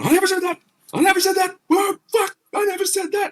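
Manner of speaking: very high, loud falsetto protest; hammed-up shrieking denial; mock screeching missus. Customer's missus protesting from the side, mock screeching.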